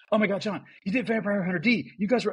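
high excited gush; fangirling. Retold or imitated. A friend fangirling to John on the phone, high excited gush. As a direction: gush like a thrilled fan. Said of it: "she's fangirling out".

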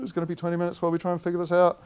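mock apologetic explanation; imitating the staff member. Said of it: "And she said".

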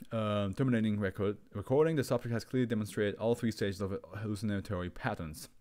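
ordinary talk, nothing marked

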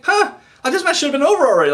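high, fast incredulous outburst; can't believe the match isn't over; baffled disbelief. Retold or imitated.

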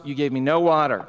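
usual speaking voice

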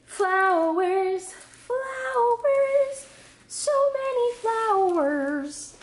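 very high, drawn-out squeal of delight; thrilled singsong squeal. Real, not acted. Delight at seeing the flowers.

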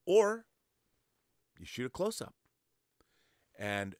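ordinary talk, nothing marked

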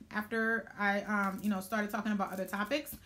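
normal talking voice

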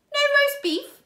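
high, loud indignant villain; outraged. A narrator's reading. Count Olaf furious there is no roast beef, loud. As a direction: hurl it back in disbelief.

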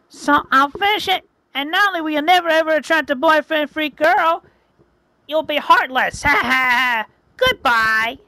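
high gloating witch with a cackle. Acted. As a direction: gloat over your fallen foe. Witch gloats over fallen hero.

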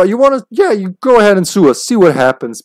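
high, loud mock dare from a company; retold blunt dare. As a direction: imitate a company brushing off a threat. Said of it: "all in nice language".

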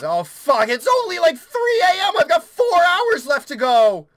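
high, loud wail; dismayed outburst. Real, not acted. Player seeing hours left to go, dismayed wail. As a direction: wail in panicked disbelief.

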